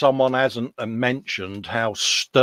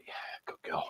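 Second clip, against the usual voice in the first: whispered; 18 dB quieter than usual. Retold or imitated. Mock-smug aside about wife.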